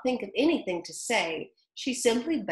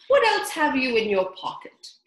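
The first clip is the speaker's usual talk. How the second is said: dodo voice; 5 semitones higher, 7 dB louder than usual. Acted. Dodo voiced in read-aloud.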